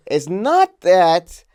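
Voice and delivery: hamming up a British boss, slow and high; mock British accent. Quoting the boss at the meeting, in a slow, high mock British accent. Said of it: "this is not a British accent at all".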